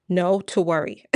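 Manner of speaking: ordinary talk, nothing marked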